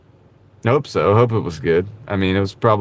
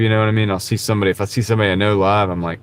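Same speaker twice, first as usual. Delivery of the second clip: usual speaking voice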